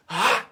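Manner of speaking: a sudden loud shouted syllable on the punch; kiai shout. Shown as a demonstration. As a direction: let the breath explode out as one loud yell. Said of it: "But when I punch".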